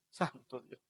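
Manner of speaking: very soft murmured awe; hushed exclamation. Acted. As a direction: breathe it out under your breath. Whispered aside. (exclamación susurrada)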